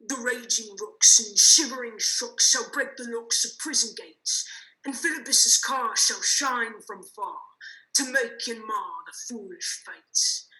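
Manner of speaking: slow, booming ranting verse; lofty tyrant rant. Acted. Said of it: "This was lofty".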